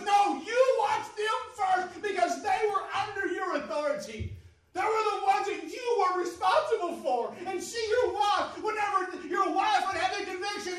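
loud, ringing sermon build; fiery preaching. Acted. Preacher warns fathers from the pulpit, loud preaching. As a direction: preach it hard to the congregation.

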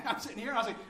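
usual speaking voice

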